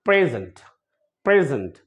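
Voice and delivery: Present with the P hit harder; stress on P. Shown as a demonstration. Teacher shows the stressed P in present. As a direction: punch the opening P of present. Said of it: "पी को थोड़ा सा तेज बोलेंगे".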